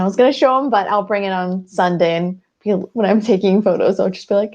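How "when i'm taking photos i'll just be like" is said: usual speaking voice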